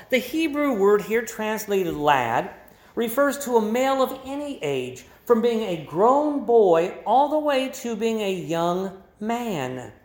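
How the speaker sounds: normal talking voice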